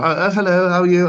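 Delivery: a quick posh English hello, lightly done; posh English accent. Shown as a demonstration. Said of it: "I could speak posh all the time".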